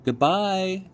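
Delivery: high, called-out goodbye; child's farewell shout. A narrator's reading. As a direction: call it to grandma across the dock. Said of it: "Abilene shouted to her grandmother".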